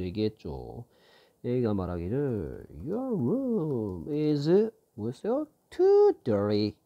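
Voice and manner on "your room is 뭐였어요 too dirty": slow drill reading. Shown as a demonstration. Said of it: "teacher slowly rebuilds the line".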